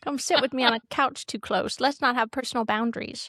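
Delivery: lisping talk-show host impression; playful imitation with slushy s sounds; Drew Barrymore. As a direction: put on a lisp, pitched slightly up. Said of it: "Drew Barrymore's twin sister who has a lisp".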